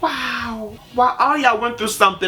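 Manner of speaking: a high amazed wow. Retold or imitated. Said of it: "I was just like".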